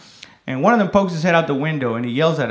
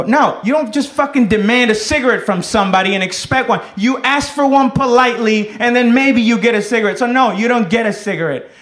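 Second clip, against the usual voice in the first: higher-pitched, emphatic acted scolding; indignant telling-off; 5 semitones higher than usual. Comic refusing a woman demanding a cigarette, indignant telling-off. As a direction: lay down the rule indignantly.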